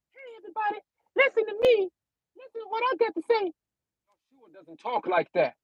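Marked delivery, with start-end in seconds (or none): 0.1-3.5 much higher, fast, thin-voiced caricature; squeaky mock voice. Preacher mocking a squeaky Messiah voice for his livestream. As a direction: mimic a weak, squeaky speaker, rushed. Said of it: "Yahshua doesn't talk like this".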